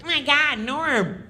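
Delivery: high, whiny mocking voice; high nasal heckle; Kattan nagging. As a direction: needle him in a high squeaky voice. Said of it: "He's like".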